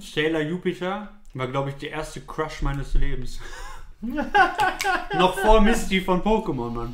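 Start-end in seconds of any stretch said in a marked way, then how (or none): 3.4-5.1 high, bashful burst of laughter; embarrassed laugh. Real, not acted. Embarrassed about a childhood crush. (verlegenes Lachen)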